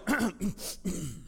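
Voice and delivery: deliberate, drawn-out throat clear; acted-out morning throat clear. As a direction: clear your throat as if readying the voice. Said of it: "So I cleared my voice".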